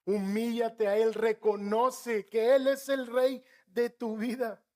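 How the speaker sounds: preacher urging the flock to humble themselves; fervent altar call. Acted. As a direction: call them to surrender, full force.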